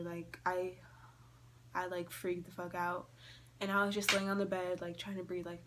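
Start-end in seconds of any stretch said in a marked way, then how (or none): none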